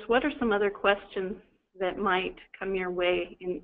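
ordinary talk

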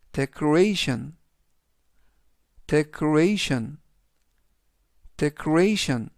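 decoration, drawn out slowly as de-co-RA-tion; slow teacher modelling decoration; slow model pronunciation. Shown as a demonstration. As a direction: stretch decoration out for learners. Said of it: "이라고 발음하시면 되겠습니다".

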